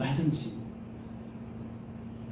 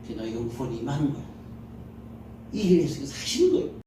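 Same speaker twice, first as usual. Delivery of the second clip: ordinary delivery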